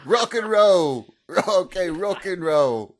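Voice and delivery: loud, slow laughing yell. Retold or imitated. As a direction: shout it drawn out, laughing. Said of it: "we kept yelling".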